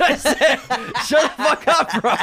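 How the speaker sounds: high, loud laughing shut up; laughing protest. Real, not acted. Laughing at his co-hosts' bit, high and loud.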